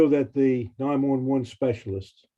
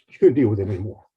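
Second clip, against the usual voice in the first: emotional voice; 3 dB louder, faster than usual. Real, not acted. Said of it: "I'm a little emotional about it".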